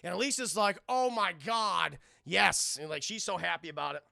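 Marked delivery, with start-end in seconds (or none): 0.9-2.8 slow loud swooning delight; thrilled. Retold or imitated. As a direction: swoon over him. Elisa thrilled at Goliath.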